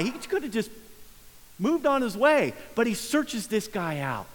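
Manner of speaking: usual speaking voice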